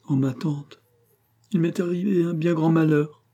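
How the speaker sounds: acted weepy confession; tearful. A narrator's reading. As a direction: wail it mildly, on the edge of tears. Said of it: "se mit à fondre en pleurs".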